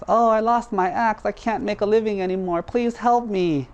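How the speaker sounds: high, whiny mock lament; fake crying. Dishonest woodcutter begs the spirit for help, fake wailing. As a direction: beg in hammed-up fake distress, drawing out the plea. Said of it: "pretends to start crying".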